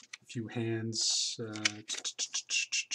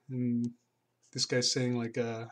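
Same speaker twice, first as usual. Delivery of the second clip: normal talking voice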